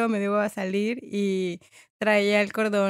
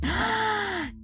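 mock gasp; 10 semitones higher, 8 dB quieter than usual. Excited welcome of guest.

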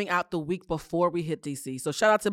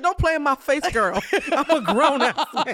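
imitating Mandy; 6 semitones higher, 4 dB louder than usual. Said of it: "Mandy said".

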